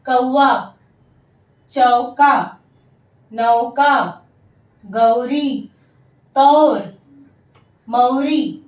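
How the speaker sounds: emphatic word-by-word drill with pauses; teacher modelling isolated words clearly; repeat-after-me reading. Shown as a demonstration. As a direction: read out each word for pupils to echo. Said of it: "मैं पढ़ूंगी और आप मेरे पीछे इसे".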